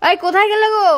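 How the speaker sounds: calling out loud to someone far; yelling across the room. Acted. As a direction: call out across the house. Calling to mom in another room.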